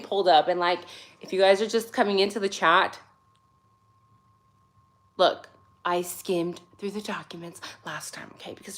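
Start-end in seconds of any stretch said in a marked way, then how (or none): 5.2-8.8 soft whispered aside. Real, not acted. To newcomers in the chat, a whisper. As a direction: let them in on it quietly.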